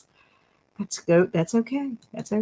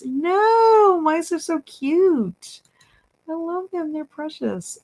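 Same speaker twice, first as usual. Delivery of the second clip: gushing over cute mice; 11 semitones higher, slower than usual. Real, not acted. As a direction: melt over how cute they are.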